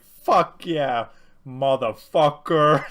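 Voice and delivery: loud, slow mock American accent; hammed-up American action hero. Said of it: "doing his best at an American accent".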